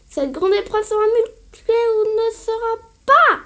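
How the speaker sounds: terreur de Mêlée Mêlée plus forte; doyen menaçant. Acted. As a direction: tonne comme un lutteur féroce. (doyen qui rugit)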